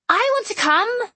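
put-on high, loud child's demand; shouting. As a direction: yell it like an eager little girl. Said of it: "Jan shouted around a mouthful of pancake".

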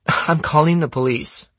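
high, indignant threat; indignant girl. Acted. Anna threatens the boys with police, indignant.

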